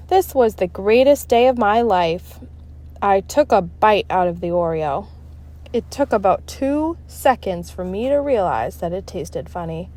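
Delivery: usual speaking voice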